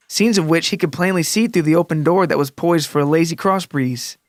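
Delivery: plain reading voice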